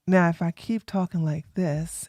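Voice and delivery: low-key, hushed demonstration voice; soft steady tone. Shown as a demonstration. Streamer shows the tone he never streams in, soft and steady. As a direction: keep it soft and even, never animated. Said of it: "at this tone, at this pace, at this softness".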